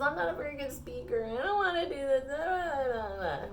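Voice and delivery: Moses whining. Acted. Retelling Moses making excuses, hammed-up tearful whine. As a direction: whimper it out, stretching the last words. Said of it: "Moses was whining".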